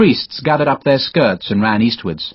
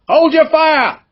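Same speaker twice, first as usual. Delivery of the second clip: shouted command; 4 dB louder, slower than usual. Acted. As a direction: bark it much higher and louder. Officer commanding riflemen in battle.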